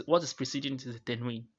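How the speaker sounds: ordinary talk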